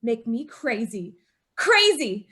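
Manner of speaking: normal talking voice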